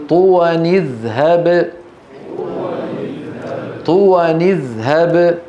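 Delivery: طوى اذهب linked as "tuwani-dhhab"; tanween with kasra. Shown as a demonstration. Teacher demonstrates the rule, طوى اذهب chanted as "tuwani-dhhab". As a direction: recite طوى اذهب measured, short i after the n. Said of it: "فنكسر النون".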